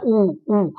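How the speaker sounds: teacher showing the students the lips for ऊ; demonstrating the sound ऊ. Shown as a demonstration. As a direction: say ऊ twice, lips pushed round. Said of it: "बोल के देख लीजिए ऊ".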